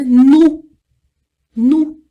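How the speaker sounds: "Nu" loud, French nous with an oo vowel; Portuguese U sound. Shown as a demonstration. Teacher drilling nous for students, loud plain U. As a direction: call out each "Nu" clearly and separately. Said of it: "U do português".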